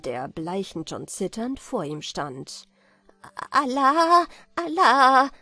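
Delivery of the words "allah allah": loud, high, trembling-merchant wail; crying out. A narrator's reading. Accused merchant calls on God, crying out in alarm. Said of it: "rief jener".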